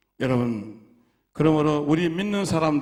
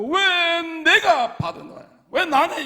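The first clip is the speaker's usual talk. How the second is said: anguished outcry; 11 semitones higher, 5 dB louder, slower than usual. Retold or imitated. Pastor furious after falling in.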